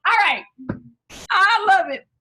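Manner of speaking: loud, high cheers for each dancer; root for them at full volume; cheering friends dancing at the party; excited cheering. Real, not acted.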